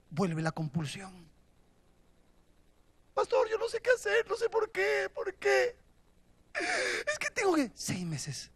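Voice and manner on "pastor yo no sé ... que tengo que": pleading man. Retold or imitated. Desperate man voiced to pastor.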